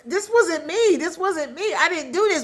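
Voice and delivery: high-pitched retold pleading; upset bride. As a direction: imitate a distressed bride protesting innocence. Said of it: "she's upset".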